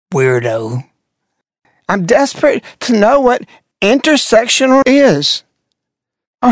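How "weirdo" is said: muttered jab. Real, not acted. Muttered insult at the ad.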